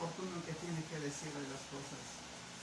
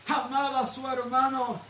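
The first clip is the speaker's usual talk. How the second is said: rising, louder preaching cadence; building sermon; 6 semitones higher, 7 dB louder than usual. Acted. Pastor preaching to the congregation about the heart, building to full force. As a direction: build the repeated lines into a loud climax.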